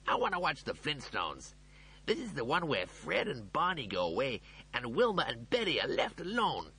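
high, insistent character voice; protesting. A narrator's reading. The cat wanting to keep watching the Flintstones, protesting. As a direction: plead the case in a high voice. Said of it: "the cat protested".

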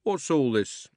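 loud, slow constable's question; slow official policeman. A narrator's reading. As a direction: weigh each word with authority. Policeman asks.

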